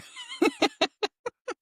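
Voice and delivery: a high amused laugh. Real, not acted. Laughing at Cody's joke about his wives, a high laugh.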